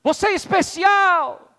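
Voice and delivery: high, loud, slow proclamation; proud shout. Acted. Father shouts praise into the mountains, proud slow shout. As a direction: chest out, shout it to the hills. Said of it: "estufa o peito e diz assim".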